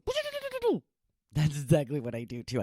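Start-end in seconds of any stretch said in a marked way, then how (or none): 0.1-0.8 very high playful do-do-do; teasing the dog with a squeaky sing-song; squeaky squish noise. Shown as a demonstration. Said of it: "reach out and go".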